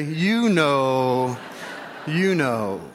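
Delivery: low, drawn-out hint; mock-ominous knowing reminder; knowing look. Shown as a demonstration. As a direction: stretch it out low and pointedly. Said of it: "Just look at him and say".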